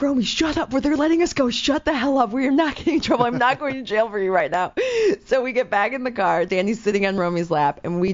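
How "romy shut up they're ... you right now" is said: put-on higher, urgent telling-off of a drunk friend; frantic scolding. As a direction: hiss at your friend to shut up, voice pitched up. Yelling at drunk friend Romy.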